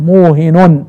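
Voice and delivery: موهن as muuhinun; nunation with damma. Shown as a demonstration. Teacher explaining the second reading, nunated موهن. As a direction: end موهن on -un with a single h. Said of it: "يعني بتنوين النون مع الضم".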